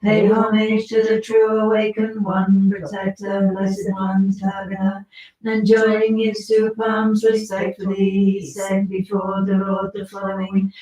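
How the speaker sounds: steady, sonorous liturgical reading; scripture recitation. Acted. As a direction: read the sacred text with devotion. Reads the Manjushri scripture aloud.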